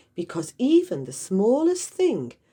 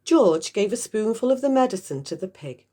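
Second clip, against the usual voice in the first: ordinary delivery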